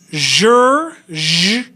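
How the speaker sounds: "zhur" begun with a buzzing zh, as in treasure; voiced zh sound. Shown as a demonstration. Teacher separating similar sounds for students, voiced zh. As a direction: stretch "zhur", then isolate the "zh". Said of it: "not sure, but zhur".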